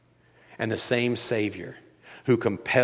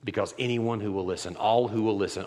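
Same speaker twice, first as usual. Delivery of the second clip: ordinary delivery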